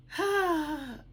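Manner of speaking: high, airy sigh; weary sigh. Real, not acted. Vlogger to viewers before telling her troubles, weary sigh.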